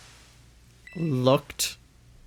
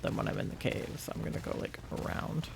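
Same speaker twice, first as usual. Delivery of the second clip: whispered aside; 4 semitones higher, 12 dB quieter, faster than usual. Real, not acted. Muttering to herself.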